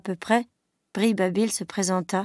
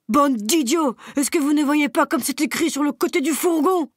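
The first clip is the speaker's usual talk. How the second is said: higher, louder angry bray; braying insult; 6 semitones higher, 4 dB louder than usual. Acted. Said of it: "se mit à braire Benjamin". (âne furieux qui brait contre les animaux)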